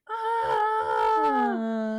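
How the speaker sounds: long, high-pitched "aah"; retold overwhelmed "aah". Host re-enacting her reaction to the scene, long high wail. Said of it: "And I was like".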